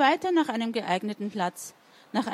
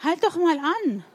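higher, mild storybook call; 6 semitones higher, 3 dB louder than usual. A narrator's reading. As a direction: call someone to a halt, lightly acted. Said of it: "aber die Zwiebel rief". (leicht gespielt rufen)

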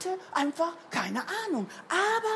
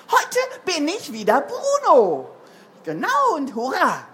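joyful shout; 2 semitones higher, 9 dB louder than usual. A narrator's reading. Bruno announces he is himself today, shouting joyfully. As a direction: shout it out in triumph. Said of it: "Da lachte ... und schrie".

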